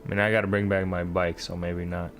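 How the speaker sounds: ordinary delivery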